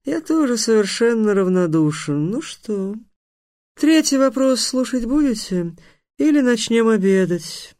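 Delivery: lofty sphinx posing a question in a high voice; high-pitched riddling monster; sphinx, indifferent and playful. Acted.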